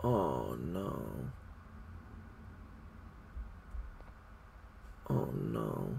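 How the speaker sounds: very low, fading "oh no"; hushed dread. Real, not acted. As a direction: sink almost to nothing in dread. Dreading what is coming back.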